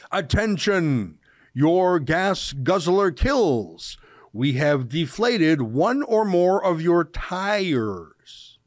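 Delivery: reads the flyer slowly, word by word; slow mocking read. Shown as a demonstration. Host reading the activists' flyer aloud, slow and mocking. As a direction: lay out each word with mock gravity. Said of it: "I'll read it".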